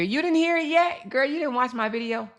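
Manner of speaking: squealed mock surprise at a viewer; high teasing disbelief. Real, not acted. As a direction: shoot the pitch up in playful disbelief. Teasing a viewer, pitch jump.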